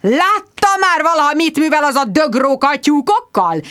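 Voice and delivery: high, loud red-faced bellow. Acted. Squire bellows at Bridget about the fox, furious. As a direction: roar at her, red in the face. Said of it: "bődült rám vérveres arccal".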